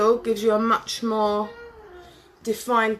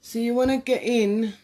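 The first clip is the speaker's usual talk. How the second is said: mascara face; 2 semitones higher than usual. Real, not acted. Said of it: "see my funny face I'm making".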